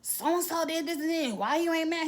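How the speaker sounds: high, indignant retold complaint; worked-up annoyance. Wife wanting her husband mad too, worked up. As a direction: push the pitch up, demanding he share the outrage. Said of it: "that pisses me off, 'cause I'm like".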